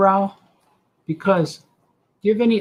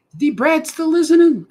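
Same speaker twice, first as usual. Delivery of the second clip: mock high voice; 12 semitones higher, 6 dB louder than usual.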